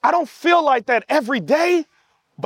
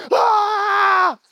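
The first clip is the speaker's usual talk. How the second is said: release shout; 9 semitones higher than usual. Pretend. Preacher showing the congregation how to shout. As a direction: let it all out in one cry. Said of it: "Some of us were like".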